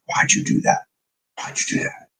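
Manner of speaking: defeated whisper; whispered despair. Real, not acted. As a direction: whisper it as if betrayed. Trade went against him.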